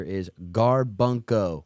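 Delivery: Garbunco slow and loud, every syllable hit; all-caps word. Retold or imitated. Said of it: "in all capital letters".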